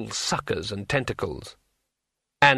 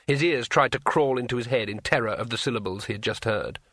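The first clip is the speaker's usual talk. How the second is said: plain reading voice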